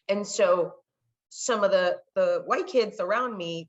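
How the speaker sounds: ordinary delivery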